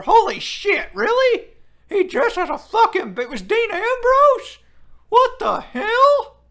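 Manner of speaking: can't believe what he's reading, voice shooting up; stunned disbelief. Real, not acted. Streamer reading chat, stunned that Ambrose wore a bear suit, loud disbelief.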